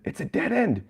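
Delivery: high-pitched panicked cry without the volume; acted panicked squeal; mock screaming. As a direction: cry out in alarm, held back. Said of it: "they're all screaming".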